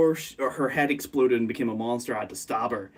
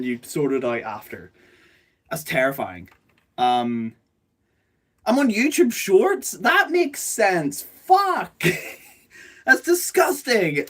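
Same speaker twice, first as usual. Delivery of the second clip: high, loud shocked yelp; startled shriek of disbelief; shocked outburst; 5 semitones higher, 4 dB louder than usual. Real, not acted. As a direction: squeal in real horror at the discovery. Shocked to find herself on Shorts.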